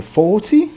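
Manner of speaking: FORTY stressed on its first syllable; stress on four. Shown as a demonstration. Teacher summing up the contrast, stress on FOR in forty. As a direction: keep the "ty" of FORTY light. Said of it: "é o four que é sílaba tônica".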